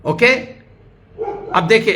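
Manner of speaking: ordinary talk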